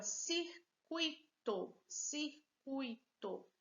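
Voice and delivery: circuito slow, cir-cui-to; syllable by syllable. Shown as a demonstration. Teacher presenting a word to students, circuito slowly sounded out. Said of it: "Três sílabas".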